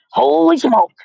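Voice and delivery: high, loud startled yell; startled cook yelling. A narrator's reading. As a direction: yelp it out in alarm. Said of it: "the man was yelling".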